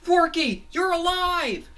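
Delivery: very high, loud cartoon cheer; overjoyed shout. Fangy sees Forky revived, overjoyed shout.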